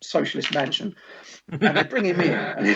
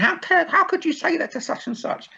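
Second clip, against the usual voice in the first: mock indignation; 13 semitones higher than usual. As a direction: imitate a scandalised viewer confronting someone. Acts viewer accosting soap actor.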